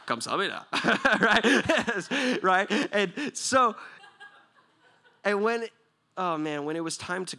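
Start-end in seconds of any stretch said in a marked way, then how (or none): 0.1-1.2 laughing thanks. Real, not acted. Laughing Korean thank-you. 1.2-5.7 loud, high laughing asides. Real, not acted. Preacher laughing with the crowd about his Korean guard friend.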